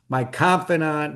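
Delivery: ordinary delivery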